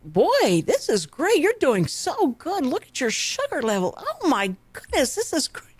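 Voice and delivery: high, mock-delighted medical staff; imitated amazed congratulations, high and sing-song; gushing praise. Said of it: "they went".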